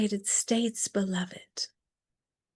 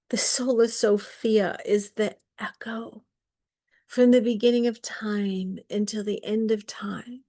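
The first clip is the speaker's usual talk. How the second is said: normal talking voice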